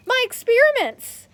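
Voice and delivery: loud, high panicked shout; cry of dismay. Acted. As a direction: yell after the lost notebook. Notebook thrown over the fence.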